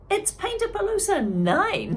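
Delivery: high, loud proud announcement; proudly. Acted. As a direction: beam with pride. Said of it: "said Rosie proudly".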